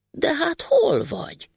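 high, slow bewildered question; puzzled robber. Acted. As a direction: peer around confused.

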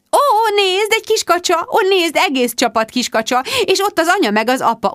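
high, loud gushing over the ducklings; squealing at ducks. A narrator's reading. Rebecca to Mark, distracting him with the ducks: high gushing delight. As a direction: coo at the baby ducks like a child.

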